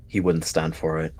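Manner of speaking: ordinary delivery, nothing marked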